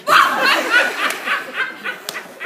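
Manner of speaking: mocking guffaw. Stepbrother mocks Bubba.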